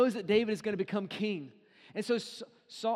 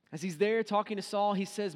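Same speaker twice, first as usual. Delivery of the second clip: ordinary talk, nothing marked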